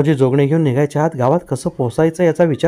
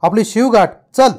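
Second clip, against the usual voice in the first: much higher, urgent cry; narrated urging shout; 6 semitones higher than usual. A narrator's reading. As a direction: urge the horse on, pleading in a high voice. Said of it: "तोंड नेऊन ओरडला". (घोड्याला चढ्या आवाजात आर्जवी हाक)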